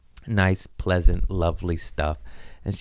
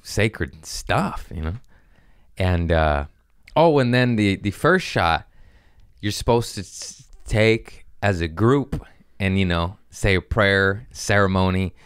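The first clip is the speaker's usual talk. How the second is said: ordinary talk, nothing marked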